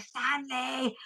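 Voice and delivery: high, soft imitation of calling a name; mock calling out. Friend calling her nickname from up the stairs, imitated high call.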